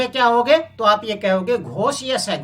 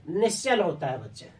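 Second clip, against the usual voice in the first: low answer voice; 4 semitones lower, 6 dB quieter than usual. Shown as a demonstration. Teacher answering her own question for the pupils, low and soft. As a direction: lower and softer, the pupils' answer. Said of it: "तो आप देखोगे".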